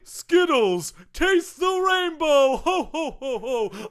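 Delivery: high, laughing mock Santa; jolly Santa pitchman. Santa back plugging another candy, jolly mock Santa. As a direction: chuckle ho-ho-ho through the candy plug. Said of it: "Santa likes candy".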